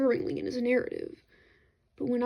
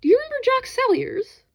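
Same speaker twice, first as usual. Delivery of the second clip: eager question; 12 semitones higher, 7 dB louder than usual. Acted. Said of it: "draw Lawrence to one side and ask".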